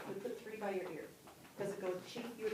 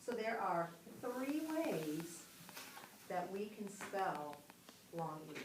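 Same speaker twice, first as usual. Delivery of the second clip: slow emphatic summary; slower than usual. Shown as a demonstration. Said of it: "teacher sums up the rule".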